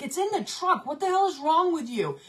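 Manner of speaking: high, exasperated imitation; imitating annoyed husband; mock husband retort. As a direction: toss it back defensively. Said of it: "He's like".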